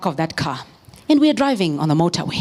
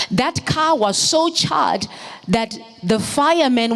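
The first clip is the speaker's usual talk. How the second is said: normal talking voice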